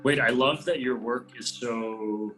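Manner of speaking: ordinary talk, nothing marked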